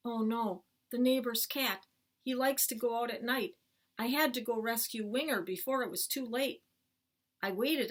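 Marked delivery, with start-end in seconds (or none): none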